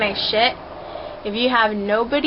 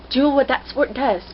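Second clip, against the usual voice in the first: usual speaking voice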